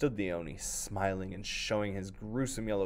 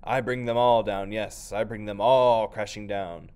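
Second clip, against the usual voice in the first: loud, slow monster boast; thundering ogre; 9 dB louder than usual. Acted. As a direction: bellow like a river ogre. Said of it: "thundered the oni".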